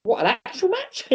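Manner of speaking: very high, incredulous inner voice; inner panic. Retold or imitated. Wrestler walking out, very high incredulous panic. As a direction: squeak with disbelief.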